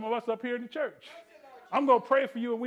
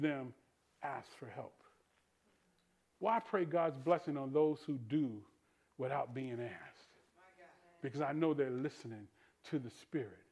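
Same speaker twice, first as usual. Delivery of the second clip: hushed preaching; 8 semitones lower, 9 dB quieter than usual. Real, not acted. Preacher drops very low.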